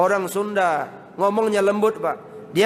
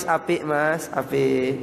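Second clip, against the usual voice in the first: gently voiced, lowered Javanese politeness; polite Javanese speaker; 5 semitones lower, much slower than usual. Javanese villager asking Mas if it is good, politely. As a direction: ask it courteously, pitched down. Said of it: "Jawa kalau ngomong luar biasa santun".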